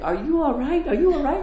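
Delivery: high-pitched, anxious checking, retold; concerned questioning. Friends asking the crying sister, with concern. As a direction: imitate worried friends, voice lifted high.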